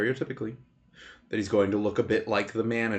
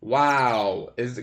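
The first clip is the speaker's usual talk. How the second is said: ordinary delivery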